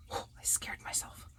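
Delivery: startled breathy whisper. Real, not acted. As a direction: gasp it out under your breath.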